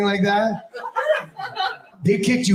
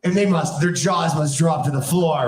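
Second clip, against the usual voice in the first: ordinary talk, nothing marked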